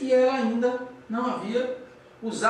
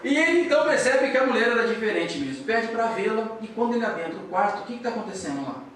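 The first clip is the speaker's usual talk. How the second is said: usual speaking voice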